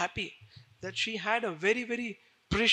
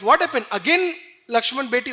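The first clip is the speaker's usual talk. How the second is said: loud question; 3 semitones higher, 8 dB louder than usual. Acted. Kaikai asks Mantra again.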